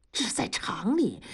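urgent unvoiced whisper. Husband hushes wife in the factory, urgent whisper. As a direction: hush her so nobody hears.

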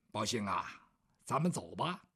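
hushed whisper to his servant. Acted. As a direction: whisper it low and soft, let's go. Bao Gong quietly calls servant.

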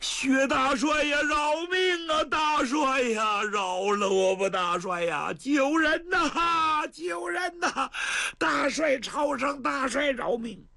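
high, drawn-out wailing plea; begging for mercy. Acted. The captured prince begs Marshal Xue to spare him, slow high wailing. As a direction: grovel and wail for your life. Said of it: "净说拜年的话呀".

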